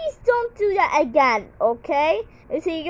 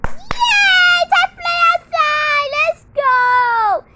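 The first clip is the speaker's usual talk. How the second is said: excited cheer; 10 semitones higher, 6 dB louder than usual. Pretend. Playtime announced to toy class.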